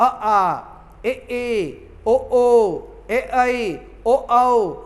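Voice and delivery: each vowel आ ए ओ ऐ औ called out clearly; vowel sounds. Shown as a demonstration. Teacher recites the vowel sounds for students, clearly drawn out. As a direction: chant the vowels one by one. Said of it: "स्वर ध्वनि यानी".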